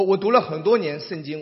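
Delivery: ordinary talk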